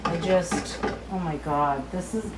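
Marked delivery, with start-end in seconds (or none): none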